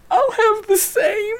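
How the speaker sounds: very high, slow, strangled squeak; mock tight, near-crying squeal of suppressed laughter; holding in laughter. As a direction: imitate someone desperately clamping down on a laugh. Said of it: "holding it in so tight".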